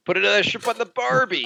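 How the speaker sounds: high-pitched hammed-up Aussie catchphrase; mock Australian accent. Host teasing co-host about his Australian dream girl, mock Australian accent.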